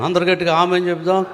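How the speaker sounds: normal talking voice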